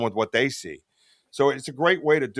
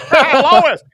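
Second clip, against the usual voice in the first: high, loud cartoon dad voice through a laugh; laughing, throw out a big cartoon "Hey, Lois"; Peter Griffin impression; 13 semitones higher, 14 dB louder than usual. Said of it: "I sound like Peter Griffin".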